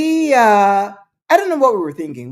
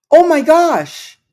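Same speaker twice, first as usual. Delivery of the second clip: caught out; 6 semitones higher, 5 dB louder, slower than usual. Retold or imitated. Guest caught eating shrimp.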